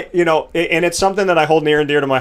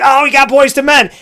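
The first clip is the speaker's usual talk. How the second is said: much higher and louder, thrilled; excited announcement; 11 semitones higher, 5 dB louder than usual. Retold or imitated. Wedding guest announcing Boyz II Men, high and thrilled. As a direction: hype the band's entrance. Imagined crowd announcing band.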